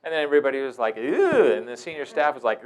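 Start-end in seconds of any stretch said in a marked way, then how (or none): 1.0-1.5 loud, high drawn-out ew; disgusted ew. Retold or imitated. Group watching the girl lick a cut, loud disgust. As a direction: recoil in disgust.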